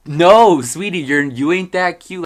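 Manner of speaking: loud, high-pitched sassy comeback; sassy clapback. Acted. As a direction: shut them down with a big drawn-out opening. Claps back at the girls.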